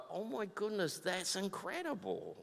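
very soft, awestruck murmur; hushed amazement. Acted. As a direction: say it under your breath in wonder. Imagined awed response to God's care.

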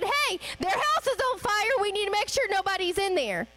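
retelling an urgent call about a burning house; urgent alarm. Retold or imitated. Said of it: "I said".

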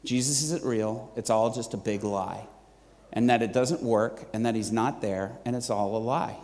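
imitating a flat voice, low and soft; low, subdued delivery drained of enthusiasm; emotionless. Waitress confessing what she told her boyfriend, emotionless. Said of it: "no enthusiasm, no emotion whatsoever".

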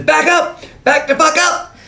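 high-pitched mock yell of warning; threatening yell. Zeus holding a gun on the gang, retold threatening yell.

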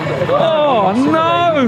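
very high, drawn-out mock wail; mock cry of dismay. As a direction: wail it in falsetto, hammed-up. Mock cry over the news clip.

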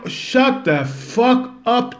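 drawn-out, high, loud telling-off; slow high shout. Acted. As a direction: bark it slowly at the imagined opponent. Snapping back at mocked opponent. (långsamt, högt och argt tillrop)

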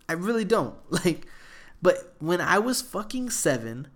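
usual speaking voice

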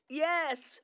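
a hint of a terse answer, high and soft; curt reply.

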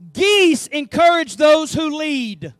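slow, loud preaching cadence; full-force preaching. Acted. Preacher proclaiming to his congregation, slow and loud. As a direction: drive each word home to the congregation.

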